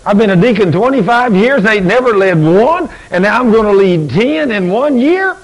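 can't believe what he just signed; disbelief. Retold or imitated. Shocked at his own promise.

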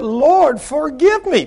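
high, loud imitated plea; dramatised urgent prayer; pleading repentance. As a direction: cry out for forgiveness as she did. Woman's plea to God, retold.

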